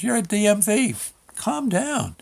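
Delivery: high-pitched clerk talking him down; reassuring clerk. Retold or imitated. DMV clerk calming a nervous customer, high and coaxing.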